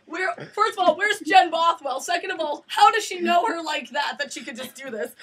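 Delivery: high, incredulous questioning; baffled indignation. Retold or imitated. Students reacting to the presenter, high baffled protest. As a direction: list the questions in mock disbelief.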